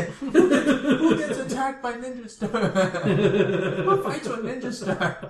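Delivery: high, laughing incredulous question; cracking up while asking; laughing disbelief. Real, not acted. Mocking the nineties ninja attack.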